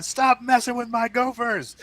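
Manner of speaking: mock call from the next room, high and loud; lightly voiced wife calling in; teasing wife's call. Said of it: "my wife's in the next room going".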